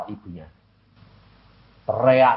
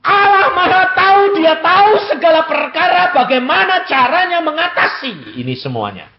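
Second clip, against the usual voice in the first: high, loud fervent preaching; preaching at full force; 9 dB louder than usual. Acted. Preacher to the congregation, high loud fervent proclamation. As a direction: proclaim it like a revivalist.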